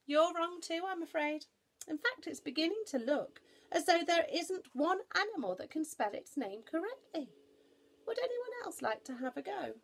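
high, loud voice to a crowd; exasperated Noah, addressing all. Acted. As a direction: deliver the verdict to the whole assembly.

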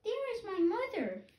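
high, small chick voice, lost and asking; a baby bird piping for its mother. Acted. Hatchling searching for its mother, high piping voice. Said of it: "my baby bird voice".

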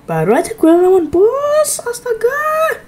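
half laughing, half crying out, slowing down; laughing disbelief. Real, not acted. Gamer laughing at facing yet another boss, laughing yelp. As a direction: crack up in despair, voice jumping up.

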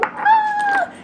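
high happy squeal; excited squeal. Real, not acted. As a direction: squeal with delight. Squeal over the polish.

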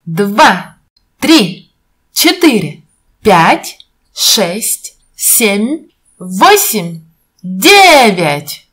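normal talking voice

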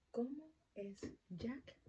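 ordinary talk, nothing marked

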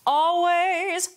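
"always" started with a glottal catch, sung high; "always" with a firm glottal start; glottal onset. Shown as a demonstration. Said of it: "that starts with a vowel".

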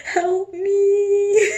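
high, loud, whimpering plea; mock desperate wail. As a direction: draw it out like a comic cry for help. Mock plea as dye goes wrong.

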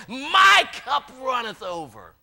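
slow, high, joyful shout; excited shout. Retold or imitated. Preacher acting David's overflowing joy as a shout. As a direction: burst out with the line at the peak of joy. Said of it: "he's getting more excited".

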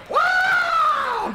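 piercing high shouted whoa, falling; high yelp. Retold or imitated. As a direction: yelp like a man being clawed. John attacked in the tree.

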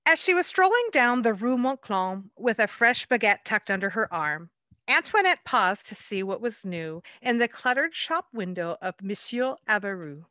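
lively picture-book read-aloud; story narration. A narrator's reading. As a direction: read it like a bedtime rhyme to children.

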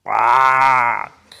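loud, long crow caw; crow call. Retold or imitated. Lone crow breaks the winter silence, loud caw. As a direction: break out a sudden drawn-out caw. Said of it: "suddenly you hear this".

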